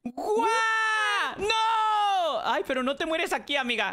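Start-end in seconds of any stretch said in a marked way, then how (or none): 0.0-1.4 long, high "guau" scream; game shout. Real, not acted. Screaming to make character jump. 1.4-2.4 dismayed scream. Real, not acted. Character falls in game.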